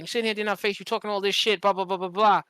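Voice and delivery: usual speaking voice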